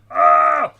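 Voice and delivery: high loud squeal of joy; short thrilled yelp; excited shriek. Real, not acted. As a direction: let out a sudden delighted shriek. Excited squeal while building.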